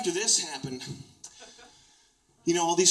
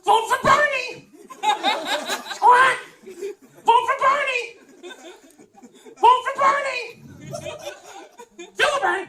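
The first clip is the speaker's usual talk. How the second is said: very high, loud parrot squawk; a parrot shrieking a slogan; squawking bird impression; 6 dB louder than usual. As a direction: screech it like a parrot. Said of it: "I would go".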